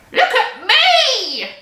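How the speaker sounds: put-on high, slow gleeful old-woman shout; Grandma shouting. A narrator's reading. Grandma shouting from the rooftop for attention. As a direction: crow for attention from the roof. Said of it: "Grandma shouted from the rooftop".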